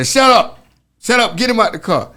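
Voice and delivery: sharp high shout of shut up; cop yelling. Retold or imitated. Cop yelling at him to shut up and get out, loud and high. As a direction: bark orders at the prisoner.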